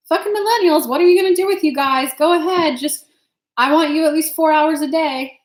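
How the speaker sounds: imitating her old boss. Part-time boss reacting to her tarot plans, incredulous and teasing. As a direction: play the gruff but kind boss, half-exasperated. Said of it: "He was like".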